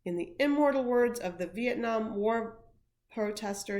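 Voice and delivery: ordinary delivery, nothing marked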